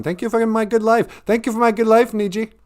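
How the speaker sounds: high mimicking spirit voice; butting-in spirit character.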